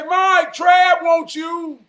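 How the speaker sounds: loud, high call across the yard. Retold or imitated. Grandmama yelling next door for Mike. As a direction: holler for the neighbor, drawn out slow.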